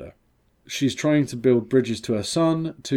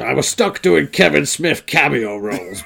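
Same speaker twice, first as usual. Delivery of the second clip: mock Carrie Fisher; 3 semitones higher, 4 dB louder than usual. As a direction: shriek it like a faded diva. Carrie Fisher parody continues.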